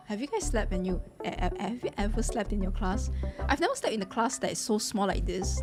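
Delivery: ordinary talk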